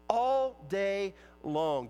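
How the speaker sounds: normal talking voice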